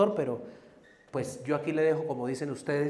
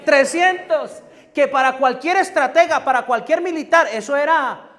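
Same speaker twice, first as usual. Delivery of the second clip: loud, high sermon cry; a fiery preacher's shout, much higher and louder; full-force preaching; 8 semitones higher, 10 dB louder than usual. Acted. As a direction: proclaim it to the whole church.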